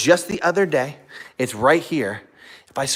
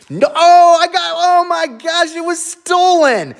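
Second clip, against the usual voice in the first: much higher and louder mock alarm; mock outrage; 7 dB louder than usual. Owner playfully shocked at the dog stealing sock. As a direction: gasp at the theft, hammed up.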